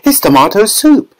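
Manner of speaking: put-on high, eager girl's voice, trailing to a whisper; a greedy girl delighting in soup; excited girl. A narrator's reading. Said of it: "shouted Violet".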